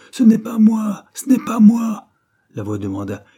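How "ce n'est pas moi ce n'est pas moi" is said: much higher and louder, desperate yell; full-throated cry of innocence; howled denial. A narrator's reading. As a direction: scream your innocence at them. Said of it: "hurla-t-il".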